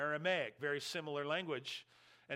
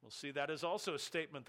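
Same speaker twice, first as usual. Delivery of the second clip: ordinary talk, nothing marked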